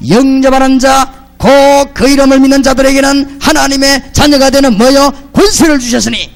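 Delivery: loud, slow, high pulpit proclamation; full-force preaching. Acted. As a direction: preach it out to the congregation.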